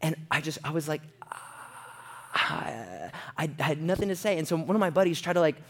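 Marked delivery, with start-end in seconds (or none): 1.3-3.1 low, soft, voiceless stalling noise; frozen "ah". Retold or imitated. Friend with nothing to say, frozen wordless exhale. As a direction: let out a long empty breathy "ah". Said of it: "I froze. I completely froze".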